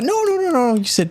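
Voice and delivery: very high falsetto, eager and cheerful; bubbly hostess. Acted. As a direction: chirp like a fussing hostess. Rose refusing help.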